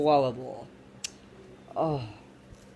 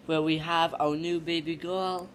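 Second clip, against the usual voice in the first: ordinary delivery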